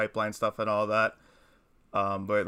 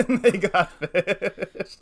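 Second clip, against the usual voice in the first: high, loud, laughing through the words; drawn out through real laughter; 8 semitones higher, 5 dB louder, much slower than usual. Real, not acted. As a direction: crack up while delivering the punchline. Laughing at own funny story.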